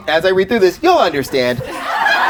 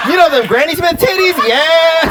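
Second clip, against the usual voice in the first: high excited laughing ask; giddy laughing; 10 semitones higher than usual. Real, not acted. Riffing loudly on the audience.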